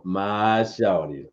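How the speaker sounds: slow, lightly voiced smooth Denzel drawl; a hint of Denzel, stretched out slow; unhurried mock Denzel cool; Denzel impression. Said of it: "hit you with the Denzel".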